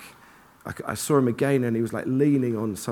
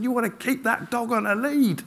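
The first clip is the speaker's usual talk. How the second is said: retold cross shout; 9 semitones higher than usual. Runner to the wolf-like dog's owner, retold cross shout. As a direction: re-enact a cross telling-off, voice raised in pitch. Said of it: "What I said, I shouted".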